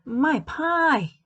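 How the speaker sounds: high, loud cry of alarm; suddenly remember the pie; alarmed exclaim. Acted. Amelia remembers her pie in the oven, crying out. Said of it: "exclaimed Amelia Bedelia".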